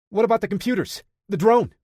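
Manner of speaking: put-on high, eager kid's voice; a worried young tech asking fast; nervous kid checking on the tech; young Parker. A narrator's reading.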